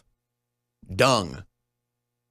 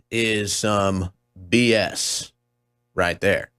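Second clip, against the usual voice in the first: slow scornful; 3 semitones lower, much slower than usual. Real, not acted.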